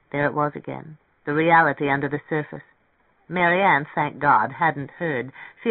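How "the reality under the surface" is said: ordinary delivery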